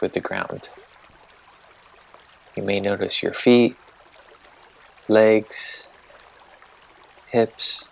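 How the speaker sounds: calm, unhurried meditation guide voice; guided meditation. Acted. Host guiding listeners through a body-sensation meditation, calm and unhurried.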